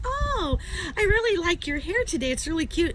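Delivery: high-pitched sugary gush; gushing compliment. Retold or imitated. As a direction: lay on the flattery in a high voice. Acts out buttering up the doctor.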